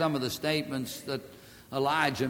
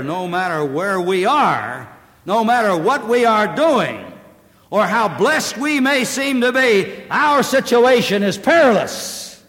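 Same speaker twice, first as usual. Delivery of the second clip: rising, louder preaching climb; preaching build; 6 semitones higher, 9 dB louder than usual. Real, not acted. Preacher warning the congregation, climbing to full force. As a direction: build each clause higher and louder.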